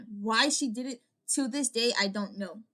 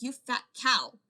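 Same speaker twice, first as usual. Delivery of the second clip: louder snapped insult; angry insult; 3 semitones higher, 6 dB louder than usual. Retold or imitated. Snapping at the fake best friend, louder. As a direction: spit it at her. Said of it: "I was so mad at her".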